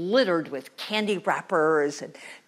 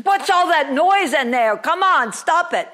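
higher, much louder telling-off; loud scolding; 7 semitones higher, 11 dB louder than usual. Acted. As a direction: shout through the metal wall. Witch knocks on metal partition.

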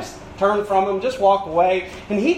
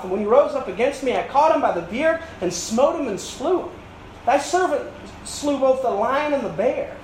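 scripture reading; 5 semitones higher than usual. Acted. Preacher reads David's speech.

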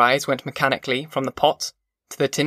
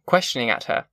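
ordinary delivery

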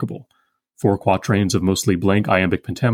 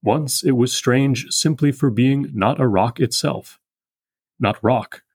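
slow, measured recital of the verse; poem reading. Acted. Lecturer rereading the poem for the class, slow and measured. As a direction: read it with the weight of the meter.

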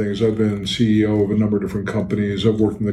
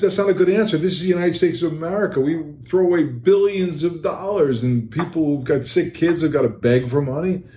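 higher, fired-up protest; indignant outburst; 6 semitones higher than usual. Retold or imitated. To his buddy at the event, higher indignant protest. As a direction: let the indignation rise as you argue.

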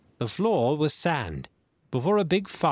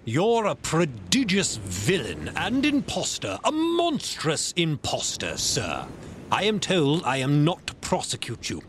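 indignant squire, voice raised on the dead men; stern squire; 3 semitones higher than usual. Acted. Squire condemns Silver to his face, indignant and stern.

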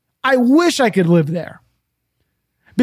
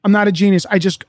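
ordinary talk

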